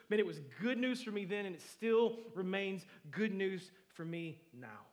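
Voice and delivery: loud, high, stretched-out proclamation; impassioned preaching. Real, not acted. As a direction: preach the good news at full force. Preaches at full force.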